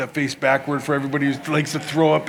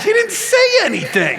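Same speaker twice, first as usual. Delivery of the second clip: high, squealed disbelief; hammed-up exasperated outburst; incredulous protest; 3 dB louder than usual. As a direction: protest it high, baffled by the crowd. Exasperated at the applauding crowd.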